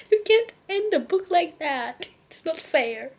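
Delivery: high and loud indignation; a comic cry of outrage; outraged protest. Real, not acted. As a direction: wail at the unfair ending. Outraged at the cliffhanger.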